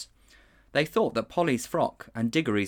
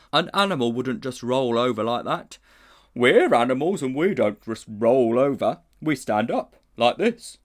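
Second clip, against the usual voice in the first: puzzled bear, louder; 3 semitones higher, 7 dB louder than usual. A narrator's reading. Bear doubting the uncle is an animal.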